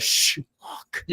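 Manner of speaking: hushed whisper. Retold or imitated. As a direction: whisper it urgently. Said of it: "everyone in synchronicity goes".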